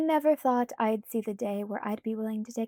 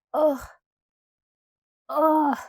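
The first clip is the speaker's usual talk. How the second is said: groaning ugh, louder and higher; loud frustrated groan; 5 semitones higher, 9 dB louder than usual. A narrator's reading. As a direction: groan in defeat at Alice. Bella groaning.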